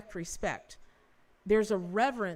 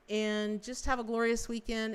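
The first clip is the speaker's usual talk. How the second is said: normal talking voice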